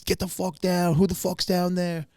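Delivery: high barked orders from a raiding cop; mock cop shout; cop yelling. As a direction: yell it down the stairs at him. Cops raiding, yelling at him.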